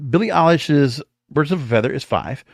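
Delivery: ordinary delivery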